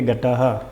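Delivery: घटः with an audible final "ha"; sounded visarga. Shown as a demonstration. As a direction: say घटः as "ghata-ha". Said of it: "विसर्ग को ह की ध्वनि निकलेगी".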